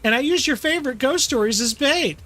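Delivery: hammed-up high guilty whine; guilty character protesting. Character guiltily confesses to friend.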